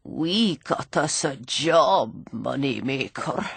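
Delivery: put-on low, slow, worn-out old drunk; cracked, ravaged voice. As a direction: croak it out through a ruined throat. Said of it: "in a cracked and ravished voice".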